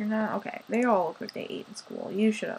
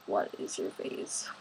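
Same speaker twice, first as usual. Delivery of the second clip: whisper; 14 semitones lower, 9 dB quieter than usual. Acted. Whispered aside.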